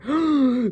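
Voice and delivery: high surprised intake; sharp gasp. Real, not acted. Fan spotting the mother's red hair, sharp gasp. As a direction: gasp at the reveal.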